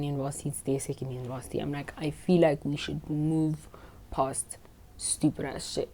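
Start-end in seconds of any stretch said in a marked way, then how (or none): 4.1-5.9 slow, soft unvoiced whisper under her breath; whispered aside. Real, not acted. Storyteller whispers a dig at the immature classmates. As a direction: let it slip out as a hushed aside.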